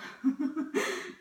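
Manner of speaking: short high laugh. Real, not acted. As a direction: burst of laughter. Laughing at her own remark.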